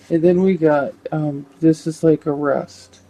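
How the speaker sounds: normal talking voice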